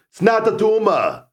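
high, loud mock Austrian accent; shouted Austrian action-hero accent; Arnold impression. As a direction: another go at the Arnold voice. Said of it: "That's a good Arnold impersonation".

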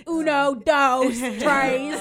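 put-on high, loud child's counting; loud, high imitation of a child; imitating daughter counting in Spanish. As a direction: count out proudly like a little girl.

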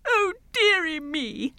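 high, slow, tearful king's lament; hammed-up sob of a pitiful king; mock sobbing. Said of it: "The king sobbed".

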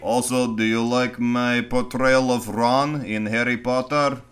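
put-on Serbian accent; joking fake Serbian voice; mock Serbian director. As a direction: play the Serbian auteur lightly. Still playing the Serbian director.

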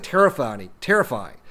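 ordinary talk, nothing marked

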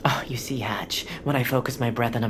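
whisper. Acted. Meditating man answers calmly.

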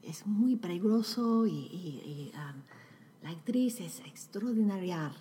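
breathy whispered Spanish; ASMR whisper. Pretend. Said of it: "Is this Spanish A S M R".

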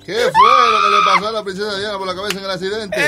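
high, loud joke-setup shout; loud shouted repeat. Pretend. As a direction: belt the setup to the room.

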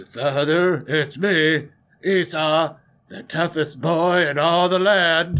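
put-on tough-guy voice, announcing himself; fake brother voice. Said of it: "put on your best brother accent".

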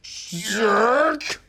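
defensive shout. Acted. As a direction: shout your innocence at the press. Player yelling back at host.

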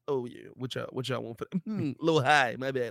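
soft, low haggling with a put-on French accent; mock French accent. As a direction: play a half-hearted French negotiator, quietly. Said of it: "I don't know why I made him French".

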